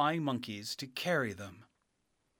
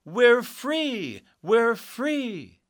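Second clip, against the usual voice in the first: high, loud cry of freedom; little people cheering; 12 semitones higher, 10 dB louder, much slower than usual. Acted. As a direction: shout it for joy. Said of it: "the little people cheered".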